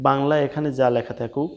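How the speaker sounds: ordinary talk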